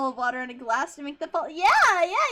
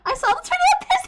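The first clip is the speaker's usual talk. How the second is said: very high, loud squealing burst; excited squeal; 11 dB louder, faster than usual. Real, not acted. Streamer squealing at chat over a silly line, very high and loud. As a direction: shriek it out in giddy disbelief.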